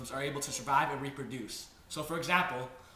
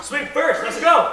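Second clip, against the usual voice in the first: high loud laughing taunt; laughing shout; 11 semitones higher, 16 dB louder, slower than usual. Acted. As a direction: yell it through a laugh. Fight challenge, laughing.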